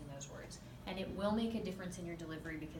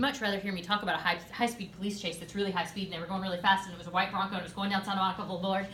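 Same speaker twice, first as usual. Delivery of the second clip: louder, fast hyped-up chase; fast excited telling; 5 dB louder, much faster than usual. Shown as a demonstration. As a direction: rush it as if the chase were thrilling. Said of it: "if I told you that way, it would be wrong".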